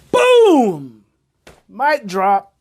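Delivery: loud, high triumphant boom; excited shout. Real, not acted. Coach celebrating her main point, excited shout. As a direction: slam it down like a mic drop.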